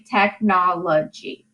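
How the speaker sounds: "Technology" slowly split into four syllables. Shown as a demonstration. Teacher counting syllables for students, slow split "Technology". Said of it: "four syllables".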